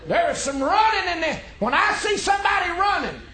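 high, loud preaching outburst; little tantrum. Real, not acted. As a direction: let it burst out like a tantrum. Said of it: "sorry about my little tantrum".

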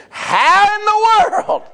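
high, drawn-out astonished cry; amazed exclamation. Real, not acted. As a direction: stretch it out in wonder, loud and slow. Amazed exclamation about grandmas.

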